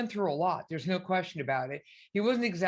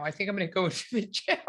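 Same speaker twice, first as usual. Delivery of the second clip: laughing through the words; much faster than usual. Real, not acted. Host laughing while quoting herself. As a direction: break into a laugh at the end.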